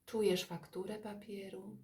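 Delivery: ordinary talk, nothing marked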